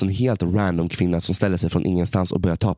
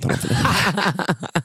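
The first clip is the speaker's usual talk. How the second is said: laughing; 8 semitones higher, much slower than usual. Real, not acted. Laughing while mocking outfit.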